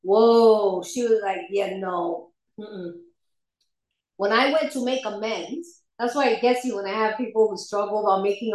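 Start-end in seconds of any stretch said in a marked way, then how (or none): 1.5-2.9 low, soft, firm no; quiet refusal. Retold or imitated. Sponsor reacting to her inventory, low quiet refusal. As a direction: imitate a sponsor gently shutting it down.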